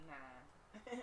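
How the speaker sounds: a sulky shrug of a word; glum tooth. Acted. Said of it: "who just like".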